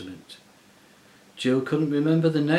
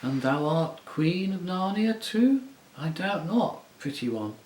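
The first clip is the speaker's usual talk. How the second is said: coaxing half mocking; 7 semitones higher than usual. A narrator's reading. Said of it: "coaxing, half mocking tone".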